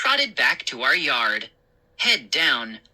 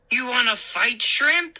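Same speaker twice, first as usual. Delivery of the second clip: taunting; 8 semitones higher than usual. A narrator's reading. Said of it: "he asked the little statue".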